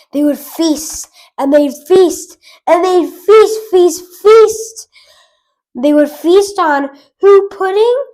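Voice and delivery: building excitement. Acted. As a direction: climb with every feast.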